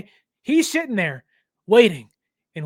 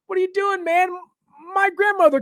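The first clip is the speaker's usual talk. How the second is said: exasperated; 11 semitones higher, faster than usual. Real, not acted. Exasperated at quarterback.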